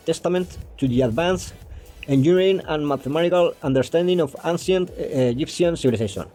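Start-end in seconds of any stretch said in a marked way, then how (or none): none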